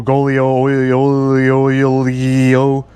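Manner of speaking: haltingly trying "golio, olio" the Italian way; fumbled Italian name. Real, not acted. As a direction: stumble over "olio", reaching for an Italian sound. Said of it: "I can't say the name in the correct accent".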